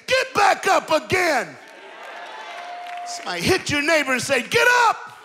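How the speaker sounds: high, loud, rousing sermon shout; preacher rallying the congregation to rise again, full-force preaching. Acted.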